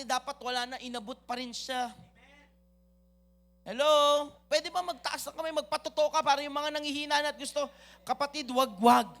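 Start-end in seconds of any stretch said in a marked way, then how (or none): 3.6-6.4 calling out. Real, not acted. Calling out to the congregation.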